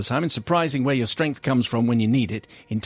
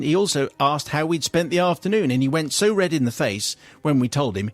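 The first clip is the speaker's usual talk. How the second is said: ordinary talk, nothing marked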